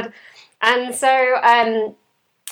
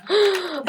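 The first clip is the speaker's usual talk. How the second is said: sharp high gasp of remembering; sudden gasp; 11 semitones higher, 3 dB quieter than usual. Real, not acted. Host remembering the topic, sudden high gasp. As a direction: gasp as it comes back to you.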